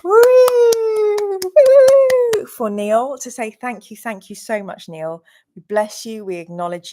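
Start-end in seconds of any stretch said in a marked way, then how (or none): 0.0-2.4 two high, loud cheers; cheering woo. Real, not acted. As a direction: whoop like a crowd giving an ovation. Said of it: "I'm gonna do a standing ovation".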